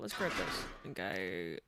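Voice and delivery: ordinary talk, nothing marked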